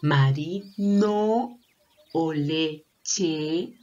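slow "Marino" and "leche", endings held and lifted; sounded out choices. Shown as a demonstration. Teacher asking which ends in E, drawn-out "Marino" and "leche". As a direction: offer "Marino" or "leche" syllable by syllable. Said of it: "que termine con la vocal E".

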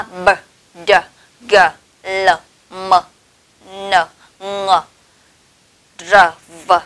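"b, d, g" and the rest as separate short voiced sounds; isolated voiced phonics sounds, b to v; voiced consonants. Shown as a demonstration. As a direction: sound each consonant alone with voice and a pause. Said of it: "nó sẽ là hữu thanh".

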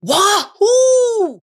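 high whooping cheer; excited whoop. Acted. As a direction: let out a joyful wahoo. Kid cheering on the way to swim.

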